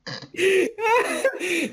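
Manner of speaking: high cackling laughter; long laugh. Real, not acted. Friend remembering an old shared joke, cracking up.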